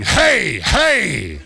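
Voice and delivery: high barked "Hey, hey!" like a seal; seal's shout. Retold or imitated. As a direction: yell it out like a seal on a ringtone. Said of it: "ringtone of Chucka saying".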